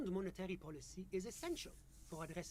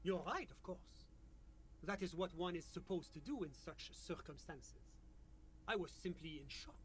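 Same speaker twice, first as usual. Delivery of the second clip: put-on French-accented official; measured, polite French accent; 8 dB louder than usual. GM voices official NPC.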